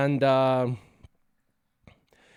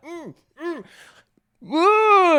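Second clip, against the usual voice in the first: straining noises; 5 dB louder, much slower than usual. Retold or imitated. Brother pulling dart out.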